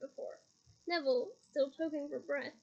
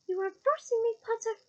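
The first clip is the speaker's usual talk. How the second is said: raised threatening voice; angry; 7 semitones higher, 7 dB louder than usual. Acted. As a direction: snap it at him, higher. Umbridge threatening Harry.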